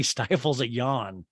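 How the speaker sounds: ordinary talk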